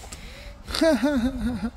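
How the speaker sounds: high-pitched chuckling laugh. Real, not acted. Owner amused by the dog, high chuckle. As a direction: laugh in a high, rippling run.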